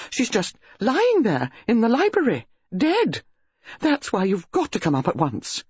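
high, gushing country lady; excited hostess. A narrator's reading. Mrs Bantry telling Jane about the body, high gushing. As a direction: rattle on, thrilled and scandalised.

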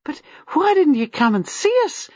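demanding. Acted. Gwenda to Miss Marple, demanding question. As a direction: press her for an answer. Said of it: "demanded Gwenda".

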